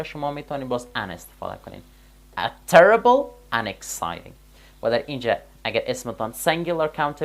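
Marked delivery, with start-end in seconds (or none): 2.4-4.3 "A terrible and exciting" said slowly and louder, setting each word apart; article example. Shown as a demonstration. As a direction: stress the article "A" before "terrible". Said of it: "با حرف بی صدا آغاز شده باشه". ("A terrible" آهسته و با تأکید)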